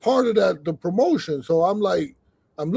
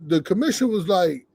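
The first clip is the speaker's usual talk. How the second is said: ordinary talk, nothing marked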